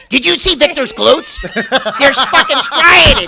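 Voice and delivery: loud, slow, laughing gush; mock excited gushing. Host acting out backstage gushing about a rival's glutes.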